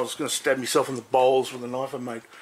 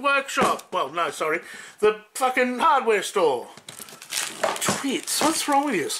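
angry rant; 10 semitones higher than usual. Real, not acted. Ranting at the hardware store again.

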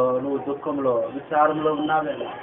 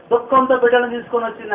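ordinary delivery

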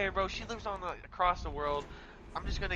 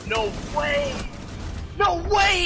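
surprised shout; 10 semitones higher, 7 dB louder, much slower than usual. Real, not acted. Surprised at game move.

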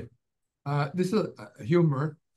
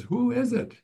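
high squawky parrot, pitched way up; 10 semitones higher, 3 dB louder than usual. Acted. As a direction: play the parrot calling through the door. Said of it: "the parrot says".

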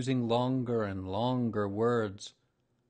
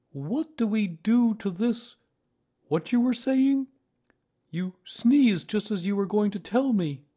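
high, loud bear voice, questioning; puzzled Pooh Bear; 12 semitones higher, 9 dB louder than usual. Acted. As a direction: interrupt Owl in a loud, high-pitched bear voice.

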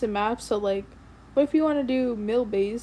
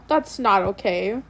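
ordinary talk

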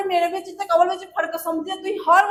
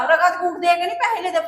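ordinary delivery

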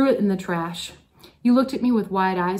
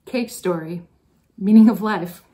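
the class calling it out together, louder on meaning; students' loud reply; 7 dB louder than usual. Retold or imitated. Teacher quoting her advisees' request, mock loud. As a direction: echo the students' demand, punching the end. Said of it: "and you responded".

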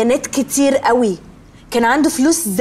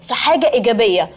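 ordinary delivery

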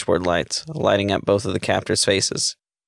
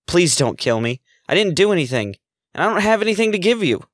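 rising ever higher, frightened begging; pleading; 9 semitones higher than usual. A narrator's reading. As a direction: beg for your life, climbing in pitch. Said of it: "Satsuki started to plead".

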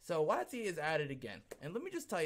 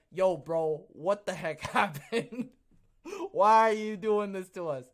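indignant friends; 5 semitones higher, 10 dB louder than usual. Retold or imitated. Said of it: "I know Egyptian people who saw this and went".

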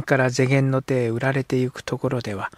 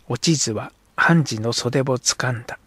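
ordinary delivery

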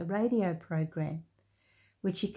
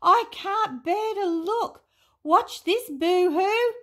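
high, loud exclamation; pitched-up animated fable voice; excited character voice; 12 semitones higher, 6 dB louder than usual. A narrator's reading. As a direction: cry out high over the story.

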